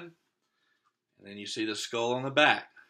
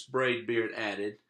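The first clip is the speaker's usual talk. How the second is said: usual speaking voice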